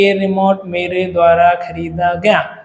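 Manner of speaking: slow, droning demonstration; slow monotone, word after word; boring. Shown as a demonstration. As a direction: drag it out to sound boring. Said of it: "तो कितना boring हो रहा है ना".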